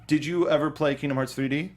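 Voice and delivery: ordinary delivery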